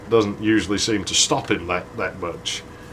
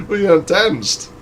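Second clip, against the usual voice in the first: put-on girl's teasing voice; 7 semitones higher, 3 dB louder than usual. Girl answers on dance floor.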